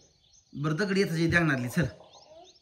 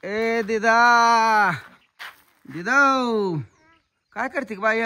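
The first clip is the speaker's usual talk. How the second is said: long drawn-out high shout; calling out; 5 semitones higher, 6 dB louder, much slower than usual. Real, not acted. Parent calling the child in the morning, calling out. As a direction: holler the name, stretching the vowels.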